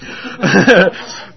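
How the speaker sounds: short, loud chuckle; self-conscious laugh. Real, not acted. Preacher doubting his own British accent, self-conscious laugh.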